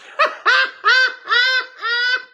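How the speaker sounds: shrieking helpless laugh; high squealing laugh. Real, not acted. Host losing it at the viewer's letter, squealing laugh. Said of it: "laugh at letter".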